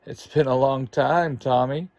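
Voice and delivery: usual speaking voice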